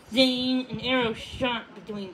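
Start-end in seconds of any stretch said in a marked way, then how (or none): none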